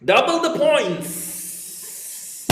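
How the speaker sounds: high, very drawn-out game show call; hammed-up announcer. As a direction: stretch the last word out like a show host.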